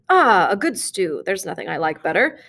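ordinary delivery, nothing marked

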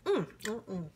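ordinary delivery

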